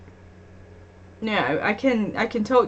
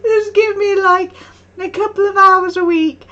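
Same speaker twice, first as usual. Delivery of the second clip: desperate plea; 10 semitones higher, 8 dB louder than usual. Retold or imitated. Begging the shop staff for a job, in hammed-up desperation. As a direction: mock-desperate begging, breaking into a cry. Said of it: "I'm just like".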